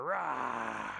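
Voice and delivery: playful fire-breathing "rah"; dragon roar. Pretend. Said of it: "We're dragons with breathing fire".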